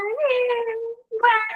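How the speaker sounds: imitating a baby's whiny cry, pitched high; mock baby wail, high and whiny. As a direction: whimper like a fussing baby. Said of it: "e começa a chorar".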